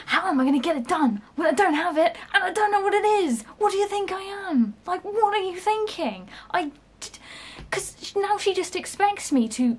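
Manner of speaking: high, loud, disbelieving outburst; exasperated rant. Real, not acted. Ranting about the boss's unfair demand, exasperated. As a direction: vent it like you can't believe it. Venting rant, raised pitch.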